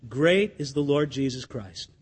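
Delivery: low, soft and earnest; preacher declaring his faith softly; sincere declaration. Real, not acted. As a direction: say it quietly with conviction. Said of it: "But I'll say this".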